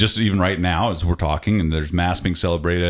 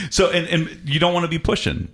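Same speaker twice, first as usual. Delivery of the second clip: laughing; 7 semitones higher than usual. Real, not acted. Laughing after the accent joke.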